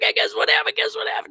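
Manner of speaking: bursting to share big news; giddy excitement. Retold or imitated. Rushing home to tell girlfriend.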